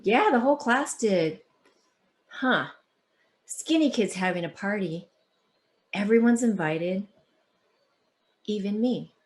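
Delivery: expressive read-aloud storytelling; story narration. A narrator's reading. Author reading her book to the audience, expressive. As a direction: tell it like reading to a class.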